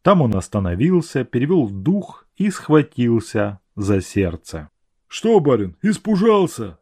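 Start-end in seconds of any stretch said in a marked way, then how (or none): 5.1-6.8 put-on old watchman voice. A narrator's reading. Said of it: "спросил его сторож".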